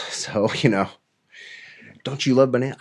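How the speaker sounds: normal talking voice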